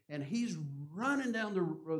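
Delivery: ordinary talk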